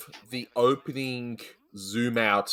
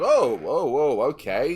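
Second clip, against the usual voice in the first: retold surprise; 4 dB louder, slower than usual. Host retelling a first reaction, mock startled. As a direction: act taken aback, then settle. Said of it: "I was like".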